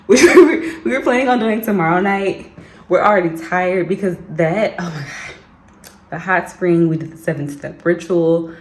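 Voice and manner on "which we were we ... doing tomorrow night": laughing. Real, not acted. Laughs at the timing.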